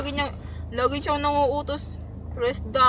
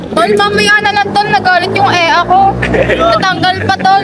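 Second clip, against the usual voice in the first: shouting; 5 semitones higher, 16 dB louder than usual. Real, not acted. Shouting across to friend.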